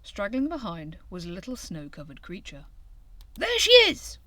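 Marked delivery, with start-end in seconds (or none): 3.4-4.2 high, loud excited cry; eager shout of recognition. A narrator's reading. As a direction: call out in delight as Hare. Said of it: "cried Hare".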